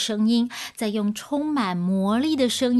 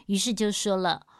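ordinary delivery

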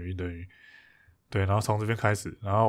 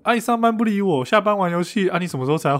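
imitating a fierce scolding, pitched much higher; 9 semitones higher than usual. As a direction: replay the partner's harsh nagging as a mock role. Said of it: "然后你就超凶的". (模仿对方超凶地质问，音调明显拔高)

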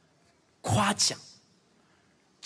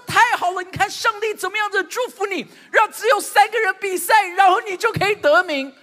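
higher and louder, near-shouted joy; mock excited praise; 9 semitones higher, 6 dB louder than usual. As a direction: gush over the win, playing it up. Father overpraises a tiny contest. (夸张兴奋地大声称赞)

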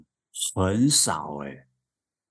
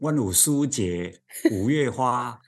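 slow Taiwanese Mandarin vendor call; Taiwanese Mandarin accent; 3 semitones higher than usual. Retold or imitated. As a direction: hawk brand names in Taiwanese Mandarin accent. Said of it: "就会用台湾国语腔". (台湾国语腔叫卖)

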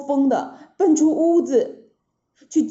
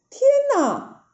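shocked gasp; 13 semitones higher than usual. A narrator's reading. Narrator shocked at demon.

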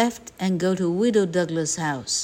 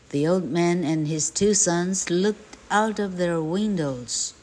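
ordinary delivery

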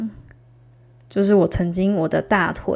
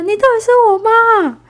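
high, loud exasperated protest; can't believe she's your mom; indignant disbelief; 9 dB louder than usual. Retold or imitated. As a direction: daughter protesting her laughing mom. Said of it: "我就觉得".

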